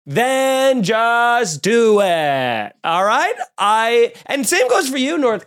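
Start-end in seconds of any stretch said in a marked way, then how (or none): 0.1-2.7 very slow, each word punched; slow fed-up challenge; exasperated, drawn out. Real, not acted. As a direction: stretch out then just do it. Ranting at countries.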